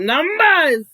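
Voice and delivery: mocking, drawn-out loud holler; Jay Wilson. Vlogger mimicking a rival's boasting, loud drawn-out holler. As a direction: stretch it out high and loud in mockery. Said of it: "get on here and talk about".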